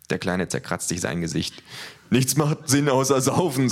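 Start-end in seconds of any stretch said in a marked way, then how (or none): 2.1-3.7 slow, dopey thug voice; dim big thug. Acted. The big thug proposes drinking, slow and dopey. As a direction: drawl it like the dumbest one.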